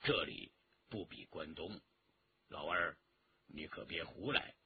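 low, hushed warning voice; cautioning elder. A narrator's reading. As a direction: warn him quietly, pitched well down. Elder warns his companion.

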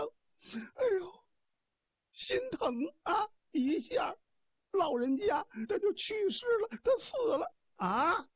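high sobbing wail, put on for the husband; faked crying. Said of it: "一再的哭".

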